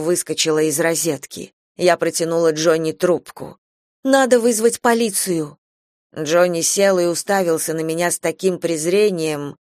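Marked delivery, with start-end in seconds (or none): 4.0-5.6 higher-pitched panicked insistence; frightened demand; insistent alarm. A narrator's reading. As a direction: press him urgently, pitched up. Mother repeats urgent plea.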